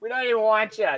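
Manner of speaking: playful Boston fan taunt, easing off at the end; mock Boston accent. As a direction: jeer in a put-on Boston accent. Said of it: "trolled them with Boston accents".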